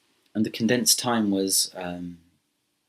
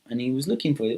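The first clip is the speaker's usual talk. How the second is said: ordinary delivery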